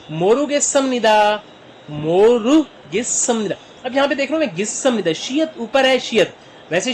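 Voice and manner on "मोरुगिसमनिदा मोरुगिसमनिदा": slow, careful Korean; slow model phrase. Shown as a demonstration. Teacher slowly models the polite Korean reply. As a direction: stretch each syllable.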